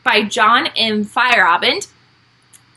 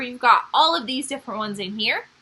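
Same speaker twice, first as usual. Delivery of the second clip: ordinary talk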